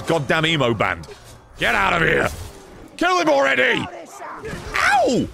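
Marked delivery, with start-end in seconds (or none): 1.6-3.9 shouted command, rising higher; urgent shouted order. Real, not acted. Shouting orders in a game fight. 4.7-5.3 very high, sudden ow; pained yelp. Real, not acted. Hit in the game.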